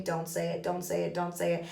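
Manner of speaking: soft, low repeated plea; hushed inner chant; saying to himself. Retold or imitated. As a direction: imitate a husband silently hoping, under the breath. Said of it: "saying to himself and hoping".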